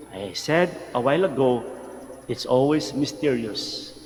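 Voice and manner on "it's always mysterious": ordinary talk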